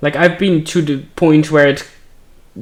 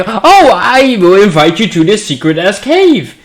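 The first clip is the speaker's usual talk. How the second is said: mock cheerful invitation; 5 semitones higher, 7 dB louder than usual. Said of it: "It's not like".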